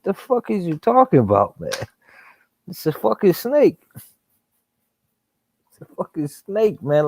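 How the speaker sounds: high, exasperated disbelief; incredulous. Retold or imitated. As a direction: pitch it up in total disbelief. Retold disbelief at coworker's snake.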